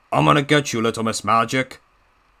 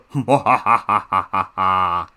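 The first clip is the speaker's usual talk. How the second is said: hammed-up evil laugh in an altered voice; gloating villain laugh; 3 semitones lower than usual. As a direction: cackle like a cartoon villain.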